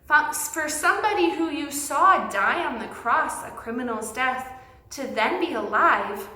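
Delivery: ordinary delivery, nothing marked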